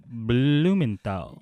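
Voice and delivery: slow, stretched suspicious name; drawn-out officer voice. Retold or imitated.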